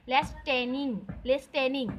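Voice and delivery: "लिसनिंग" with the silent t sounded, lis-TEN-ing; spelled-out pronunciation. Shown as a demonstration. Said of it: "लिसनिंग को हम actually पढ़ते हैं लिसनिंग".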